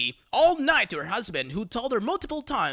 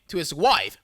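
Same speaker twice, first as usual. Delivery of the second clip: ordinary talk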